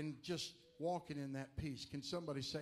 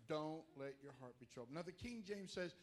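ordinary talk, nothing marked

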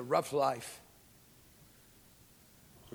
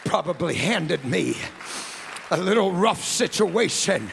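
loud, higher shouted preaching; preaching at full force; 8 semitones higher, 9 dB louder than usual. Acted. Preacher rousing the congregation to praise, full-force shout. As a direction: build to a roaring altar call.

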